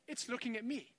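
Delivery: ordinary talk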